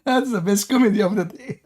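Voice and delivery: high-pitched, breaking into laughter; laughing disbelief. Real, not acted. Host on the opponent's exit, laughing. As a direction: speak through helpless amusement.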